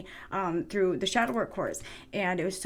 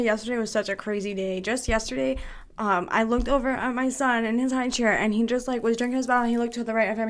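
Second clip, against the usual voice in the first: ordinary delivery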